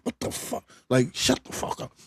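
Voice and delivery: under-the-breath disbelief, whispered; muttered to yourself on a bus, barely voiced; whispered inner reaction. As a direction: whisper it like a thought you'd never say aloud. Inner reaction to annoying lady.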